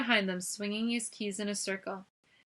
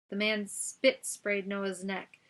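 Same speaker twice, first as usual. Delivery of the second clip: usual speaking voice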